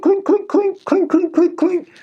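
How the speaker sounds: high, pinging imitated clink of metal. Telling viewers about weapons dropping in a cell, high ringing clinks. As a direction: tap out each clink like metal on concrete.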